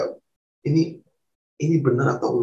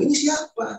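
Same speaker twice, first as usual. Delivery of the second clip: very high, bewildered question; retold shock, pitch leaping up; startled; 3 dB quieter than usual. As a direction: ask it high, taken aback. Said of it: "Di situ saya kaget".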